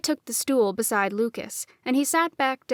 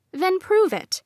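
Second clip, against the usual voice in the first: higher and louder dare; challenging; 9 semitones higher, 5 dB louder than usual. A narrator's reading. Girl daring Lucas to prove magic, loud challenge. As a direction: throw down the challenge. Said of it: "I raised a challenging eyebrow".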